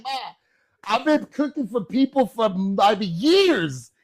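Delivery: high, loud protest; hammed-up comic outrage; incredulous son. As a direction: wail it at Ma in disbelief. Son protests mother's confession.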